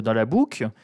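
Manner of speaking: "boucle" said in a Northern French accent; "dans la boucle" slipping into a Nord accent; Northern accent slip. Real, not acted. Mocking the rich, "boucle" slips into Nord accent. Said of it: "c'est mon accent du Nord qui revient".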